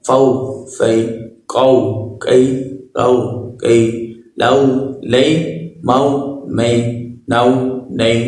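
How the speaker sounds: short syllables read smoothly in turn; teacher reading syllable pairs aloud for children; fluent reading drill. Shown as a demonstration. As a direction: read each pair gently, without stretching. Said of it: "آئیے ہم اس کو رواں پڑھتے ہیں".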